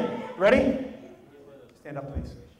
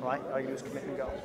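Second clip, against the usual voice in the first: softer, lower, soothing coach voice; calm reassurance; 6 semitones lower, 8 dB quieter than usual. Real, not acted. Coach softly reassuring a scared volunteer. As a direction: settle her down gently before the run.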